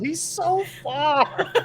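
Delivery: high, slow, laughing whine; Cecil whining. Acted. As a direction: drag out the complaint through a laugh. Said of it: "this is Cecil".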